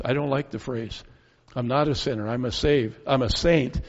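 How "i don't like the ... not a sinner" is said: ordinary talk, nothing marked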